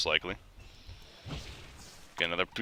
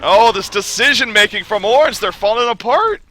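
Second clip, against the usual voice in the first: shouted, high-pitched live commentary; excited play-by-play; 10 semitones higher, 7 dB louder than usual. Acted. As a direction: yell the action as it happens, loud and high.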